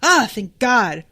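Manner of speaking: high, loud flustered realisation with a laugh; mock panic, then relief at spotting the sword; hammed-up right-right-right with a relieved thank God; sudden realisation.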